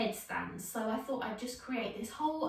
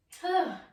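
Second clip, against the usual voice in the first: heavy exhausted sigh; 7 semitones higher, 7 dB louder than usual. Real, not acted. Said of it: "out of breath now".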